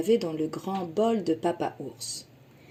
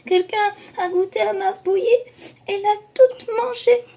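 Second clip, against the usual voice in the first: very high, loud sobbing little bear; Baby Bear over his eaten porridge, sobbing; crying baby bear; 14 semitones higher, 7 dB louder than usual. Acted. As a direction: wail it out in tears. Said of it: "se remit à pleurer".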